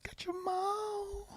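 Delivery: breathy slow whisper, high and soft; slow eerie whispered catchphrase. Pretend. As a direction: hush it out like a secret chant.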